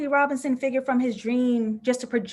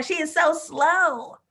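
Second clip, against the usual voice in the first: mock complaint; 6 semitones higher, slower than usual. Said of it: "Everybody on Twitter was going".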